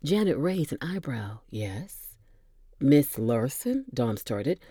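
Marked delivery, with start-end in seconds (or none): 1.5-2.2 barely voiced prompt; whisper. Janet prompting Dom in a soft whisper. 2.8-3.8 puzzled. Acted. Dom asks, puzzled.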